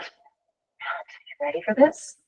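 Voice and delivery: slow, breathy whisper; a hushed, deliberate warning; blunt verdict. Retold or imitated. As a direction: whisper the hard truth slowly. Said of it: "I'm like".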